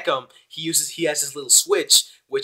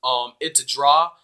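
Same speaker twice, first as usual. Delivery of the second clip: ordinary talk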